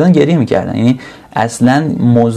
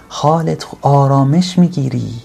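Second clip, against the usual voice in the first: ordinary delivery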